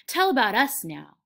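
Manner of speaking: loud, eager demand; happy cry. A narrator's reading. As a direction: beg happily for the story. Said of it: "Lenny cried happily".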